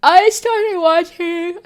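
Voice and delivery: high, slow words stretched by a real yawn. Real, not acted. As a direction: talk right through a yawn. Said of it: "Sorry, I'm yawning".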